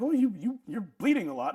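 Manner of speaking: high, loud, stammered alarm; mock alarm. Piercer notices her customer bleeding, mock-alarmed. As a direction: imitate someone startled, loud and high at first.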